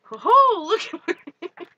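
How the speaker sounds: high, loud "ho ho" exclamation; delighted outburst. Real, not acted. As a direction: crow with delight, breaking toward laughter. Delighted outburst, then laughs.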